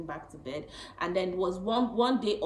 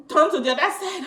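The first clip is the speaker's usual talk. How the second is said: laughing husband; 8 semitones higher, 10 dB louder than usual. Retold or imitated. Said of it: "He was like".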